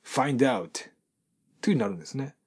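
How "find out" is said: "find out" run together as fine-dout; English-style liaison on "find out"; linked English pronunciation. Shown as a demonstration. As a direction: link the D of "find out" onto "out". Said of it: "英語的に発音すると".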